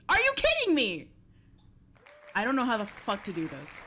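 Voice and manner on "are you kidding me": high, loud shocked cry; disbelieving outburst. Real, not acted. Streamer reacting to the wheel's pick, shocked high outcry. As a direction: blurt it out in real disbelief.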